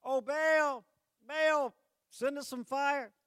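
high, loud, slow wail to Baal; overacted pleading scream for fire. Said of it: "They screamed even louder".

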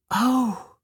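put-on light high girl's exclamation. A narrator's reading. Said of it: "Batushka said to herself".

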